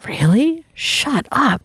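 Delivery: high, incredulous "really?" then a mock shocked "shut up"; disbelieving friend. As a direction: play the friend who can't believe it.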